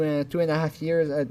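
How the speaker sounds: ordinary delivery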